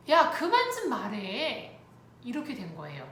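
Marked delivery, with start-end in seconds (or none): 0.1-1.8 loud, high, slow telling-off; re-enacted snap, words drawn out; fed-up scolding. Mother to child who keeps asking, fed up.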